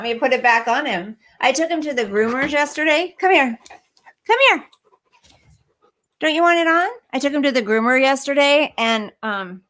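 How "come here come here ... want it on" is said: higher, louder coaxing call; coaxing pet voice. Real, not acted. Streamer trying to get the dog's shirt back on, high coaxing. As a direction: call the dog over, pleading.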